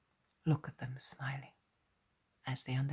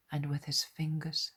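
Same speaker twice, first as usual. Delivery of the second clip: plain reading voice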